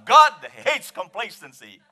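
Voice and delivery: loud, forceful call with "hates" punched; booming emphasis on "hates"; emphatic HATES. Shown as a demonstration. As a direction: hit "hates" hard for the congregation. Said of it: "Let's put some emphasis on".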